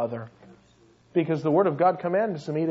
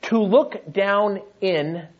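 "To look down in" said slowly with each word stressed; slower than usual. Shown as a demonstration. Preacher assembling the Greek word, slow and deliberate. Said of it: "The third word would be in".

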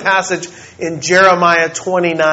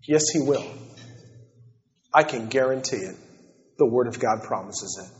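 hushed earnest appeal; 3 semitones lower, 5 dB quieter than usual. Real, not acted. Quiet earnest appeal to listeners.

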